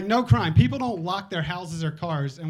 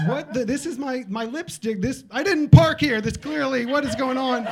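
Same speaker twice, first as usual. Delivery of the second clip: hammed-up baffled outburst, pitched up high; mock confusion; 7 semitones higher, 5 dB louder than usual. Driver finding their car moved, hammed-up baffled yelling. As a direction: act out a baffled car owner. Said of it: "they go back to confusion".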